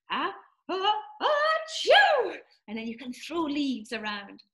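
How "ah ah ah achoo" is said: high loud pretend sneeze; fake sneeze. Shown as a demonstration. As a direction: build up and sneeze big. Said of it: "somebody sneezed".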